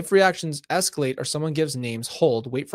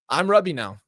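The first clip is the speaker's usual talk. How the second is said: normal talking voice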